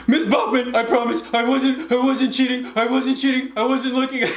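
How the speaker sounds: high, sobbing protest of innocence; mock crying. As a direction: wail like a hysterical child. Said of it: "So I start crying".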